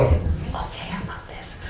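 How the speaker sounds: unvoiced near-silent whisper; whispering patron. Speaker imitating a library patron who talks far too quietly, whispering. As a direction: barely breathe it out like a timid patron. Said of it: "they talk like this".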